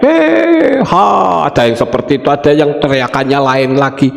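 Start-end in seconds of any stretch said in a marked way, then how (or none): 0.0-1.5 high, drawn-out "eh" dropping to "ha"; demonstrated herding call, pitched way up; shepherd's calling shout. Shown as a demonstration. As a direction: call the sheep in with a long high holler.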